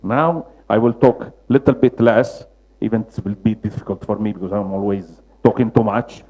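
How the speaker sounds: normal talking voice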